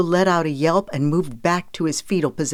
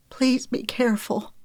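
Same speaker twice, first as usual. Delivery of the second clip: high, hushed woman's voice; worried plea; 8 semitones higher, 4 dB quieter than usual. A narrator's reading. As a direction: plead gently before he climbs down. Mother pleading softly with rescuer.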